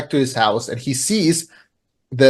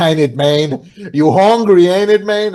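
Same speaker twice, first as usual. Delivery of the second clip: teasing tough-guy voice; 4 semitones higher, 4 dB louder than usual. Retold or imitated. Wife ribbing her starving husband, in a louder, higher mock tough-guy voice. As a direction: poke fun at him like a swaggering buddy.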